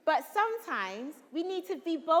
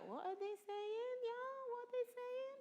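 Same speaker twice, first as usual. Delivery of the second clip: higher, much softer puzzled asking; mock confused audience; 8 semitones higher, 14 dB quieter than usual. Teacher imitating a confused audience member, soft and puzzled. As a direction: mimic a lost listener quietly. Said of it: "I was like".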